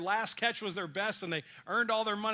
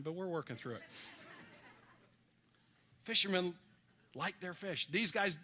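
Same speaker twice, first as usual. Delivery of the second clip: ordinary talk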